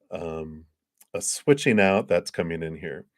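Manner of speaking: ordinary talk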